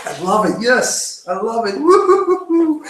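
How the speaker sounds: high, whooping acted elation; stoked adventure mindset. Shown as a demonstration. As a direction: flip into hyped-up celebration, pitch way up. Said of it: "So you alternate like that".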